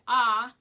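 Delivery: loud yawning ah. Shown as a demonstration. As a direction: open the throat wide in a yawn. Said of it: "when you do a yawn and you say".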